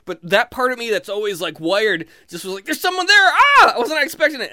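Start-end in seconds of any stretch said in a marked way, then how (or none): 2.6-3.7 high, loud startled yelp; panicked cry ending in a girly shriek; girlish scream. Retold or imitated. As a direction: yell in sudden panic, jumping in pitch. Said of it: "I screamed like a girl".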